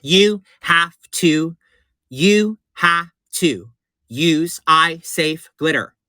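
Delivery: You have to use eye safe glitter, drawn out and weighted; slow it right down to drive it home; slow emphatic repeat. Shown as a demonstration. Repeating the safety tip to viewers, slow and firm. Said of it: "I'm gonna repeat it again".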